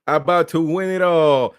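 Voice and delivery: loud, high, pumped-up show intro; hype opening. Real, not acted. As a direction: open the show like a big announcer. Show opening, hyped host.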